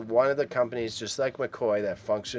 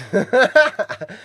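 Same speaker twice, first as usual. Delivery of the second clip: high burst of laughter; 11 semitones higher, 6 dB louder than usual. Real, not acted. Laughing at mispronunciation.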